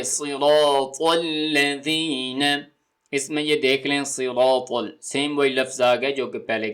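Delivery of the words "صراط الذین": drawn-out Quranic recitation; slow recitation. Shown as a demonstration. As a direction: recite slowly and carefully. Recites verse.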